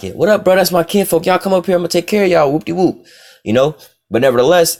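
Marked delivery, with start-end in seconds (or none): 0.0-3.0 high-pitched, excited family welcome; overjoyed welcome. Retold or imitated. Joseph welcoming his brothers in, happy and high. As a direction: throw your arms open to your kinfolk. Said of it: "It's like".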